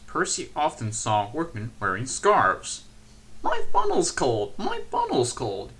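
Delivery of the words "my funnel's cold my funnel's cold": high, louder chant of a small engine; puffing little engine. A narrator's reading. Percy complains to himself about cold, in a high chugging chant. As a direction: chug it out like a little tank engine. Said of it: "he puffed".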